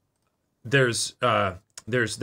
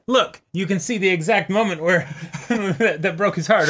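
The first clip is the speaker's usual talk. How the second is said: quoting the Bart Simpson meme through laughter, higher; laughing quote; 7 semitones higher than usual. Retold or imitated. Friend quoting a meme about heartbreak, laughing higher. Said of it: "the Bart Simpson".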